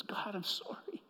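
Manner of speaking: hushed, unvoiced plea to God; whispered apology. Peter confessing quietly to God, whispered.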